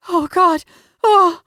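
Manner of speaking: loud, high cries of pleasure; ecstatic cry. Acted. Heroine cries out in climax.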